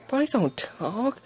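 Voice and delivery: high, slow disbelief; high-pitched, drawn-out astonishment; shocked exclamation. Acted. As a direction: exclaim it as an incredulous question.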